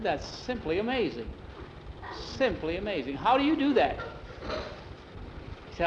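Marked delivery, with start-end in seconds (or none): 0.0-4.0 marvel, then burst into the question; amazed. Acted. Teacher watches boy's ears wiggle, amazed.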